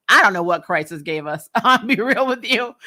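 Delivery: ordinary talk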